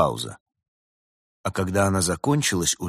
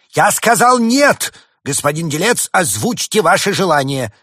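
angry; 11 semitones higher, 10 dB louder than usual. A narrator's reading. Winter spirit overrules his brother, angry. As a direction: lay down each word forcefully. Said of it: "рассердился дух зимы".

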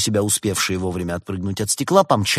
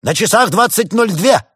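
put-on high, loud teen town crier; ringing high-pitched call; shouted announcement; 13 semitones higher, 9 dB louder than usual. A narrator's reading. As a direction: yell the time up at the ceiling. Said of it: "выкрикнул подросток в потолок".

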